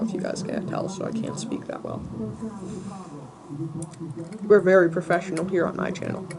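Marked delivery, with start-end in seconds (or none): 0.0-2.0 whispery, chewing gum. Real, not acted. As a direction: mouth full of gum, barely voiced. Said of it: "I'm chewing gum right now".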